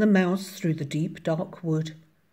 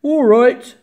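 loud, higher monster voice; amused Gruffalo; 7 semitones higher, 13 dB louder than usual. A narrator's reading. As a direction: boom it out with mock amusement. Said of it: "bursting with laughter".

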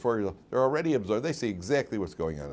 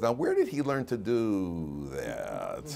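puzzled thought; much slower than usual. Retold or imitated. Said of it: "my first thought was".

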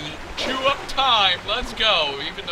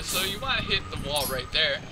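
ordinary talk

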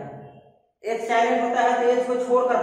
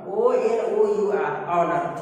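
slow spelling out; 3 semitones lower, much slower than usual. Shown as a demonstration. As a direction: spell O N O यू आर slowly, letter by letter, then land on ऑनर. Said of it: "आ, ऑनर".

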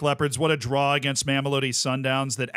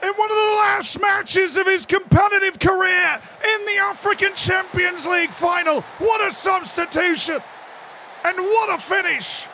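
very high, loud yelled commentary; celebrating a veteran's goal; ecstatic screaming; 4 dB louder than usual. Real, not acted. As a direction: scream it like a final winner.

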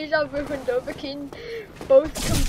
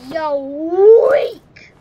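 much louder taunting shout; 11 dB louder than usual. Real, not acted. Gamer taunting a boss, loud shout. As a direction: bellow the taunt.

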